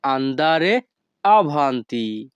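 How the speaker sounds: slow, syllable by syllable; deliberate teaching pace; slow Italian model phrase. Shown as a demonstration. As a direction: spell it out in sound for beginners. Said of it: "তখন আমাদেরকে বলতে হবে".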